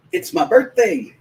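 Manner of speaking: breathless high thrill at spotting a star; awed fanboy squeal; starstruck. Retold or imitated. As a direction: gush like a kid who just saw his hero. Starstruck seeing Roger Staubach.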